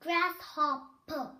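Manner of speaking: grasshopper drawn out slowly and clearly. Shown as a demonstration. Teacher modelling the word for kids, slow and clear. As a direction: stretch grasshopper syllable by syllable. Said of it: "Các bạn nói nhanh hơn nhá".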